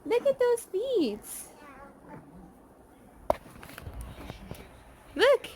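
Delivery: high-pitched, slow coo. Real, not acted. Coaxing baby to see toy.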